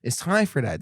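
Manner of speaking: ordinary talk, nothing marked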